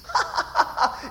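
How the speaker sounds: high, staccato burst of put-on laughter; mock laugh. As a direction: laugh as if at a funny movie. Acts out laughing at comedies.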